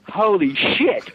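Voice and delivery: drawn-out shocked exclamation; slow, stunned holy shit. Retold or imitated. Actor sprayed with blood, slowly stunned. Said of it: "he immediately went".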